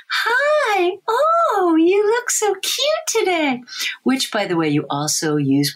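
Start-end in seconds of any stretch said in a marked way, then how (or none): 0.1-3.6 very high, squealing parentese coo; weird baby voice. Shown as a demonstration. Researcher demos the special voice parents use, very high squealing coo. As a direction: gush at an imaginary baby way up high. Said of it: "when you hear that weird voice".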